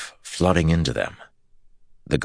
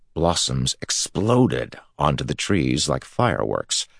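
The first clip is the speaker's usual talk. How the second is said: plain reading voice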